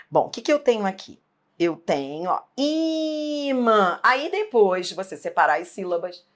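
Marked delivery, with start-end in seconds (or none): 2.6-4.0 IMÃ with the first syllable I held long and high; drawn-out first syllable. Shown as a demonstration. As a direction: stretch the I of imã as if calling it out. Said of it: "é ficar repetindo". (imã com o I bem alongado e agudo)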